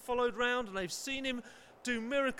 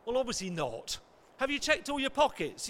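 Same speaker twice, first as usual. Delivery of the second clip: usual speaking voice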